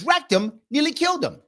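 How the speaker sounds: high, lightly voiced kid delivering the pun; son's punchline. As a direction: pitch it up like an innocent boy.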